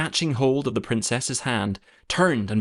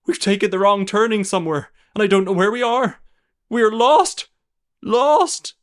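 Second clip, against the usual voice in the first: high, loud cry of terror building; rising panic, louder to the last word; panicked cry; 10 semitones higher, 8 dB louder than usual. A narrator's reading. Nurse realizes they are lost, in terror. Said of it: "gave a great cry".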